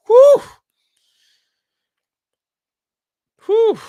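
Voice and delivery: loud high whew, twice; emotional exhale. Real, not acted. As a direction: blow out a big emotional breath. Emotional after reading the farewell.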